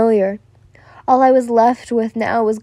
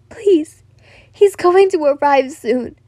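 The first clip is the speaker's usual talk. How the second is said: higher, urgent plea; begging; 4 semitones higher than usual. A narrator's reading. She cuts me off at the door, begging. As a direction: plead with a touch of panic. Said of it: "she begged".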